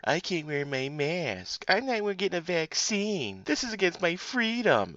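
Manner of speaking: high, slow, whiny mock voice; mocking anti-masker. Ranting podcaster mocks anti-maskers in a high whiny voice. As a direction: whine "I can't wear my mask" like a spoiled idiot.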